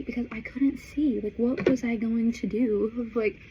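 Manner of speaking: ordinary delivery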